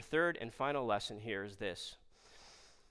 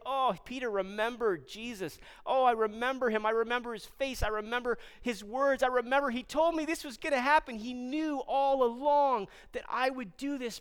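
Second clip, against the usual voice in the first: raised, impassioned inner outcry; Peter's anguished remembering; 10 semitones higher, 4 dB louder than usual. Acted. Peter recalls Jesus after denying him, raised and impassioned. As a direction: build higher and louder as memories flood in.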